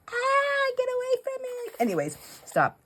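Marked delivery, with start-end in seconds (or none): 0.1-1.7 very high mock shriek of disgust; mom recoiling. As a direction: squeal like a mom who hates velvet. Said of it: "She's like".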